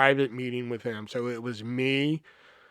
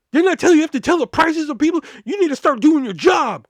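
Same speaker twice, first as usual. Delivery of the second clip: loud, high, rapid scolding; imitating a furious manager's high-pitched tirade; angry boss yelling; 13 semitones higher, 10 dB louder than usual. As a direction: chew out an employee at a shout. Boss chews him out on phone.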